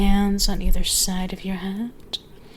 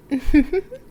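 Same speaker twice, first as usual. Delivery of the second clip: rising, loud teasing laugh; high playful giggle; amused laugh; 8 semitones higher, 6 dB louder than usual. Acted. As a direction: laugh at their fluster. Amused at listener's blush.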